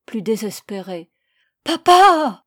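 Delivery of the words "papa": high, loud terrified shriek; a horrified boy screams at his father. A narrator's reading. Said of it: "hurla l'enfant épouvanté".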